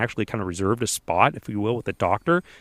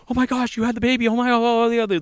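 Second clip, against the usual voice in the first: much higher, frantic mock panic; nurses freaking out; 13 semitones higher than usual. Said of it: "the nurses were freaking out".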